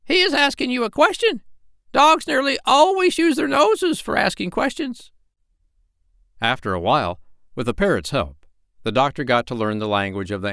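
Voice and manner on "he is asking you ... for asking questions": very high, squawky bossy parrot. Acted. Parrot Polynesia scolding the doctor, very high bird voice. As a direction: peck at him with a shrill bird voice.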